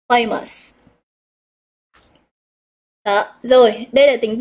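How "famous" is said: famous falling away after FA; stress on first syllable. Shown as a demonstration. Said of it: "Chúng ta sẽ nhấn stress vào một". (nhấn âm đầu của Famous)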